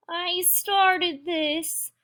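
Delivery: high, drawn-out moan. A narrator's reading. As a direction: wail it slowly in a small high voice. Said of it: "moaned Pip Squeak".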